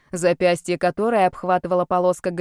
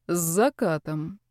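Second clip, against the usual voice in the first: slow, hollow spirit voice; a lifeless stone statue, slowly; 2 semitones lower, 3 dB quieter, slower than usual. Acted. Spirit repeats the girl's words.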